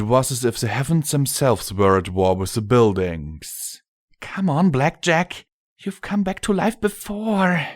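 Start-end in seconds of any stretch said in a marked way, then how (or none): 4.2-7.7 put-on high girlish plea; wishful young daughter. Scotch Tape alone, willing Blackjack back, high pleading voice. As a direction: urge it in a high voice.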